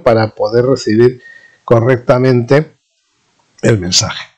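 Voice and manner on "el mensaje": normal talking voice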